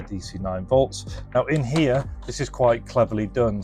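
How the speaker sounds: ordinary delivery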